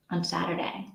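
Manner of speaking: breathy whispered aside; murmured under the breath. Real, not acted. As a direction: drop to a whisper, half to yourself. Whispering to herself mid-chat.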